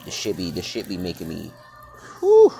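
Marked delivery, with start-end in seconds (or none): 2.2-2.5 high, loud burst of a whew; exasperated whew. Real, not acted. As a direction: blow out a sharp high whew of frustration. Overwhelmed by frustration.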